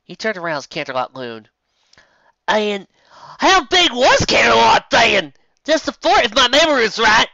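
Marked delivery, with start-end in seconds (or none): 2.5-7.3 louder question. Acted. Danny asks loudly.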